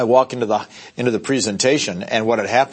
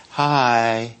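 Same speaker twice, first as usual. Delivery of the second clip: wimpy agent voice. Retold or imitated. Wimpy agent introducing self.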